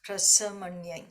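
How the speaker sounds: Prasamanyai with a light s at the front of the palate, not sh; demo pronunciation. Shown as a demonstration. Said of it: "the stress is more in the front of the palate".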